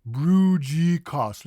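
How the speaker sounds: broodje kaas as a loud "broo-jee koss"; American mispronunciation. Shown as a demonstration. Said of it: "most Americans will say".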